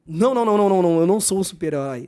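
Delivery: loud, rapid-fire protest; emphatic denial. Acted. Speaker rejects being called a superhero, emphatic denial. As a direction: wave the praise away in a quick burst of no's.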